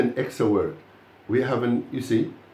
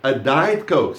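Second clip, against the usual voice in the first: Diet hit hard, Coke falling away; stress on Diet; 3 dB louder, slower than usual. Shown as a demonstration. Teacher repeats the phrase for the class, stressing Diet. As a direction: lean on Diet as the new word. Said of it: "So you stress the word diet".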